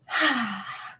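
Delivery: long, falling exhale; real sigh of release while moving; audible sigh. Real, not acted. Breathing while leading a shaking practice.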